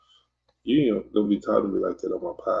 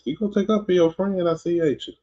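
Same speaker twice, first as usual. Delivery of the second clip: teasing high voice; 11 semitones higher than usual. Acted. Teasing the viewer who defends a friend.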